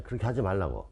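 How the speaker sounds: ordinary talk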